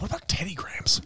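whispered question; whispering. Real, not acted. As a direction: drop to a whisper. Sudden hushed aside about snacks.

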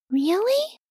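soft, high, questioning surprise; small voice, barely daring to believe; wondering, pitched well up; hopeful disbelief. Acted. Rock hears he is loved.

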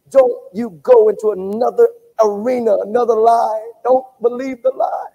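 loud, driving warning; forceful preaching. Real, not acted. Preacher warning the congregation, loud and forceful. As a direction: push the warning out hard. Preacher warns against the lie.

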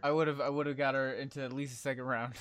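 ordinary talk

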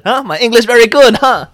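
high, loud mock foreigner boast; joking broken English. Shown as a demonstration. An English speaker mocking himself for Koreans, loud playful boast. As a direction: ham up a proud learner voice. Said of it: "I always say it like that".

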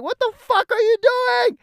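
very high, loud imitation of a panicked passenger; panicked yell. Brother to the driver heading into the ditch, panicked yell. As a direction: shriek it in mock alarm.

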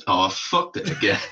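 ordinary talk, nothing marked

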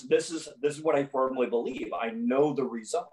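ordinary talk

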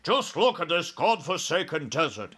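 mildly agitated exclamation; dismayed cry. A narrator's reading. Air Force chief looking at the ground below, dismayed cry. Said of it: "he cried".

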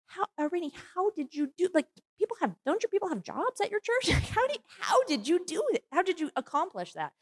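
high, astonished questioning; amazed disbelief. Retold or imitated. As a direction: marvel at how she managed it. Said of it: "I was like".

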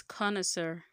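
connoisseur slowly as KAH-nuh-SUR; connoisseur, carefully, "kah-nə-sər"; correct pronunciation of connoisseur. Shown as a demonstration. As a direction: say connoisseur with a final "sir". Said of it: "what is the right pronou".

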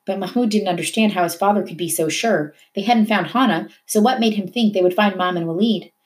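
plain reading voice

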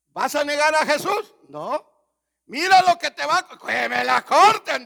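high, very loud shouting exchange; furious shout. Retold or imitated. Executioner and martyr shouting at the guillotine.

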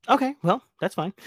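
high, loud fake-offended okay; mock surprised. Real, not acted. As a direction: feign shock, pitch way up.

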